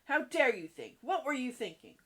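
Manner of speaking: loud, indignant burst; acted fury of a headmistress; outraged demand. A narrator's reading. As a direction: hit it hard, demanding an answer. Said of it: "demanded Miss Minchin".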